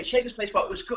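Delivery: normal talking voice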